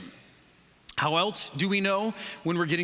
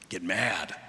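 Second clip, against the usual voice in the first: ordinary delivery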